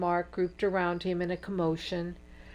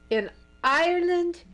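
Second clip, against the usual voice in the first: much higher, louder startled question; can't believe it, shoot it up high; disbelieving exclaim; 12 semitones higher, 5 dB louder than usual. Acted. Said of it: "exclaimed Ellen in disbelief".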